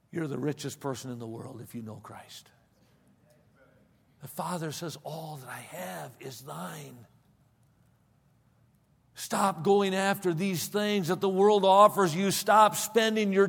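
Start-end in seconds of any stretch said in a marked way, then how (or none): 5.0-7.1 soft, slow, tender; tender divine voice. Acted. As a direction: speak it as a gentle promise. Quoting the Father's words.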